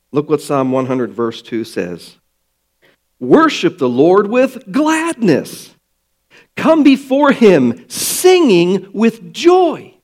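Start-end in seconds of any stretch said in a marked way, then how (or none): 3.2-9.9 joyful scripture reading. Acted. Reads scripture with joy.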